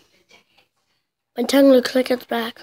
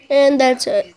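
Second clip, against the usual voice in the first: ordinary talk, nothing marked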